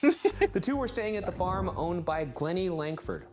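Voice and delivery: measured read, phrases settling low; story narration. A narrator's reading. Host introduces everyone staying at the farm, storytelling. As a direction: narrate the family details like a storyteller.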